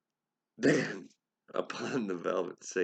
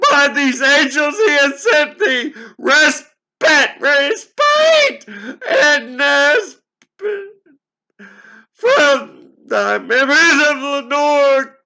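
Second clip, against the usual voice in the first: sobbing yell, much higher and louder; crying shout; 17 dB louder, slower than usual. Acted. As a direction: scream it through tears. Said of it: "I cried".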